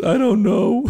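put-on very high little-boy wail; imitating hysterical child. Father imitating his clueless crying son, high mock wail. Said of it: "I'm just, I'm hysterical".

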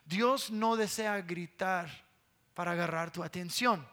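normal talking voice

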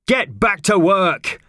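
slow, high, forceful bellow; angry order. Acted. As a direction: bellow each word as a command.

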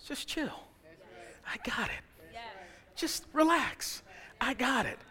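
soft and high, slow on relax; calm them down gently; hushed soothing. Retold or imitated.

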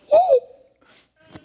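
high laughing whoop; joyful whoop. Real, not acted. Preacher to church, joyful laughing whoop. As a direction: let out a happy woo.

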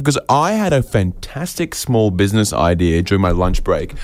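ordinary talk

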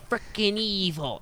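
slow, high villain voice; Doctor Evil. Retold or imitated. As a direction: drag it out like a spoof supervillain. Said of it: "I did Doctor Evil".

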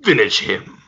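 loud, commanding video-game announcer; Mortal Kombat announcer. Acted. Unseen announcer to island fighters before the kill, booming game voice. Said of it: "hear a voice come over like Mortal Kombat".

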